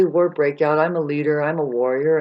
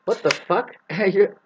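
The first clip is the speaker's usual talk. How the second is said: ordinary delivery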